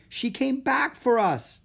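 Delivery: excited. Acted. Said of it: "Annie cried".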